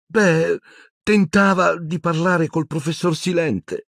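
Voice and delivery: high, hesitant stammer; faltering girl. A narrator's reading. Hermione stalling under questioning, high faltering voice. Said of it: "balbettò Hermione".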